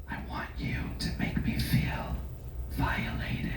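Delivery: breathy bedroom whisper; hushed seductive whisper. Retold or imitated. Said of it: "she whispers".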